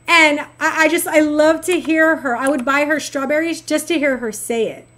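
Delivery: normal talking voice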